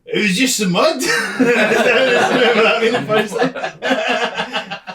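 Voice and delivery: high, loud, slow laughing. Real, not acted. Host laughing at the remembered punchline. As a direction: crack up at the memory.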